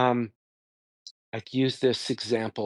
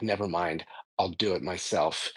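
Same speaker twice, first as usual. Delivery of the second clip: lower, softer, resigned disappointment; acting out a let-down spouse, quieter and lower; disappointed; 5 semitones lower, 5 dB quieter than usual. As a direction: drop the voice in mild disappointment. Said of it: "your wife kind of sighs in disappointment".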